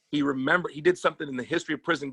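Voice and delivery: ordinary delivery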